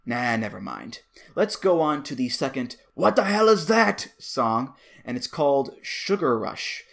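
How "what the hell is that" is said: high, loud mock alarm; mock shocked listener. Pretend listener reacting to the song, high and loud in mock shock. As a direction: blurt it like a startled listener.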